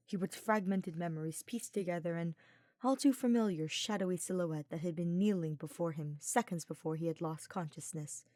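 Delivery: ordinary delivery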